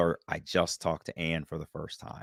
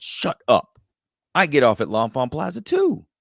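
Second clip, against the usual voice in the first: higher-pitched retold astonishment at a coincidence; amazed disbelief; 5 semitones higher, 4 dB louder than usual. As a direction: react as if you can't believe the coincidence. Retells his amazed reply to her.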